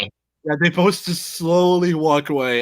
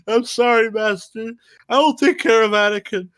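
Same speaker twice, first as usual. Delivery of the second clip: higher mock Obi-Wan; 8 semitones higher than usual. Mock Obi-Wan apologizing to master.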